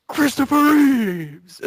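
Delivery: high, slow punchline delivery. Acted. Punchline of a pun joke.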